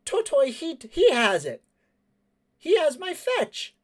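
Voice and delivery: high, loud indignant cry; high-pitched excited accusation; outraged young character. Acted. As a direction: raise the alarm, high and urgent. Accuses man, alerting Totoi.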